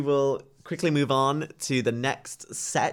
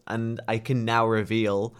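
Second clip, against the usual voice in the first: slow, drawn-out announcer reveal; dramatic winner reveal; slower than usual. Acted. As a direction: hold the suspense, then announce the winner. Announcing the national final winner.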